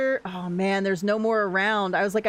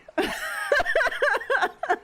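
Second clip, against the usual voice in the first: high delighted laugh. Real, not acted. Streamer laughing at a lucky dig, high and gleeful. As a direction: burst out laughing with glee. Delighted after finding treasure.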